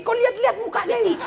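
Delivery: high, fast, laughing tease; playful jab, pitched high and fast; teasing laugh. Real, not acted. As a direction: rush it out laughing at them. Teasing the audience.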